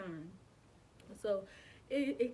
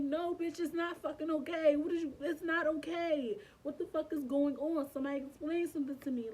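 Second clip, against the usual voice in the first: raised, high-pitched angry protest; upset outburst; 6 semitones higher, 5 dB louder than usual. Retold or imitated. Patient snapping back at the nurse's reassurance, upset outburst. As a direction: demand answers, loud and frantic.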